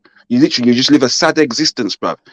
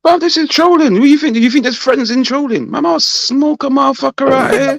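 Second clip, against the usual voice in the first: much higher, revved-up trash talk; hyped roast; 11 semitones higher than usual. Acted. Roasting a friend in chat, hyped and higher. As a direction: bring the heat like a troll battle. Heated mock threat in roast.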